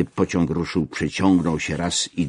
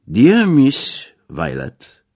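emphatic address; 8 semitones higher, 7 dB louder, much slower than usual. A narrator's reading. Greeting the housekeeper emphatically.

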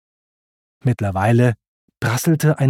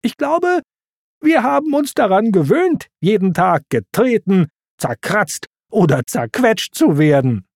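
grinsender Timon; 8 semitones higher than usual. A narrator's reading. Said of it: "Timon grinste".

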